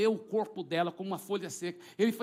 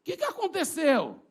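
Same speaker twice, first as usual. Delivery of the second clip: high, louder startled question; woman's bewildered voice; 11 semitones higher, 6 dB louder than usual. Retold or imitated. Freed woman seeing the crowd, imitated high and startled. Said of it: "Ela viu a multidão".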